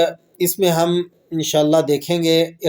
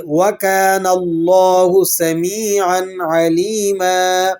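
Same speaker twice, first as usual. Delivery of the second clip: slow melodic tajweed chant; slow chanted Arabic verse; Quranic recitation; slower than usual. Shown as a demonstration. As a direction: draw each vowel out in recitation.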